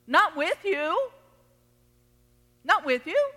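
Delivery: ordinary talk, nothing marked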